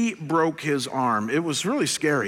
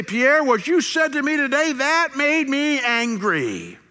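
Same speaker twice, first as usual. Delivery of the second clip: high, louder, drawn-out accusation; acted anger; 10 semitones higher, 4 dB louder, slower than usual. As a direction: put the blame on a friend in a raised voice. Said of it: "when we're angry".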